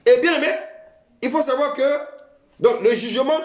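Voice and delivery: high, loud preacher's call; preaching at full force. Real, not acted. Preacher rising to the church, full-force preaching. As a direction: lift the whole congregation with it.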